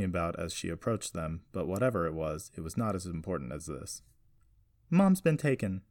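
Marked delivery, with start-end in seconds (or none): 4.9-5.8 high, loud blurt; urgent interruption. A narrator's reading. Jessie interrupting her grandfather's circle, urgent. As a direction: cut in with urgent news. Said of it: "she interrupted".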